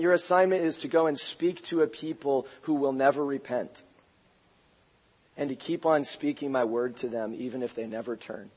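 low, soft pronouncement, fading away; grave commission. Acted. God giving Isaiah a hard assignment, low and hushed.

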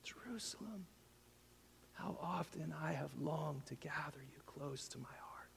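hushed, breathy grieving whisper; whispered lament. Acted. Preacher voicing Jesus lamenting over Jerusalem, whispered. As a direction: mourn it in a whisper. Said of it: "cried out".

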